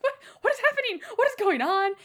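hammed-up baffled cry; shocked disbelief. Fan reacting to Gilbert's engagement, mock shocked. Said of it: "I'm just like".

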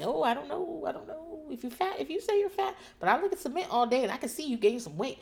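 high-pitched mock-innocent voice; mocking imitation in a high singsong; Luigi impression. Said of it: "doing a very good job of being like".

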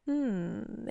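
soft, drawn-out musing hum; pondering creature. A narrator's reading. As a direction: mull it over quietly. Creature considers the offer.

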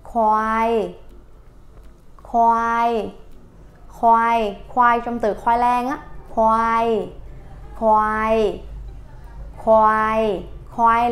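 "khoai" with a falling tone and a breathy kh at the start; Thai word demo. Shown as a demonstration. Teacher drilling the Thai word for buffalo, "khoai", falling. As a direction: let "khoai" drop at the end each time. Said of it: "tiếng Thái đọc là".

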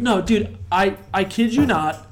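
loud, agitated telling; worked-up retelling. Real, not acted. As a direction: blurt it out loudly over everything. Excited retelling after a loud beer eruption.

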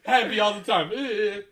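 loud, amused echo of the clip; imitating the rapper. Repeating the rapper's interview line just played, imitating him.

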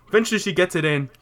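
ordinary talk

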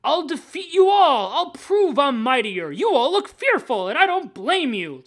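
mock-grandiose supervillain boast; hammy villain dialogue. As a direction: declaim it like an old comic villain. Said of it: "the dialogue they had is like".

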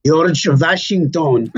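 put-on slow, proud Yiddish-accented answer; proudly answering in a Yiddish accent. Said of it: "she'd proudly answer in her Yiddish accent".

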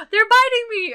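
high, loud, panicky cry; mock panicked yelp. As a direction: yelp it like you're being bitten. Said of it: "be like".

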